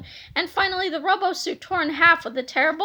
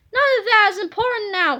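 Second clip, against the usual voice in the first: louder, higher commanding voice; imperious giant; 5 semitones higher, 5 dB louder than usual. Acted. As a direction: brush it aside like a tyrant. Giant villain dismisses.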